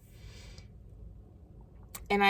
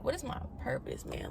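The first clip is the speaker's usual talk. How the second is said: whispered question; 2 semitones higher, 11 dB quieter than usual. Retold or imitated. Retold inner question.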